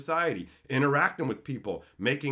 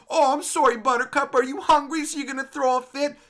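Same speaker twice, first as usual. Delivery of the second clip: loud, high, sarcastic fake pity; mocking sympathy; 10 semitones higher, 5 dB louder than usual. Customer taunts a hungry bully in line, mocking sympathy. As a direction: taunt him like a fussy child.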